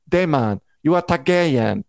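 put-on weird French accent; Mysaria's accent. Viewer mimicking the character for the audience, mock French accent. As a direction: imitate a mangled French accent. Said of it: "Mysaria's accent".

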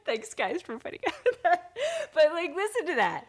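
high, laughing thanks. Real, not acted. Host laughs after singing for listeners. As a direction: laugh through the words.

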